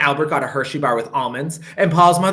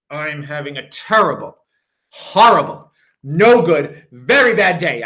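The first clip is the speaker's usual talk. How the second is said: louder declaring wail; loud complaint; 4 dB louder than usual. Acted. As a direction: announce your misery to everyone. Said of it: "I told everybody".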